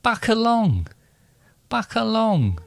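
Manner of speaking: high West Country voice; West Country accent. Shown as a demonstration. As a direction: chirp it in a West Country burr. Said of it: "West Country".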